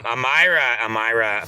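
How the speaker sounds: ordinary delivery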